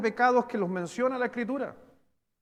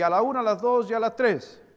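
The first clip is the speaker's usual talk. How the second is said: calling out the count; 4 semitones higher, 7 dB louder than usual. Acted. Calls congregation to read together.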